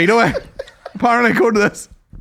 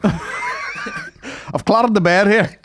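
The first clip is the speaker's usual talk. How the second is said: mock hotel complaint; 4 semitones higher, much slower than usual.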